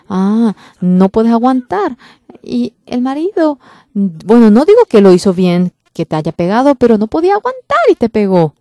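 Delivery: loud, rising sermon rebuke; preaching at full force. Real, not acted. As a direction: drive it home from the pulpit.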